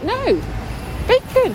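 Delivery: high, loud imitated insistence on bacon; mock upset yell. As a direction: throw his frustration up high. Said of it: "he's like".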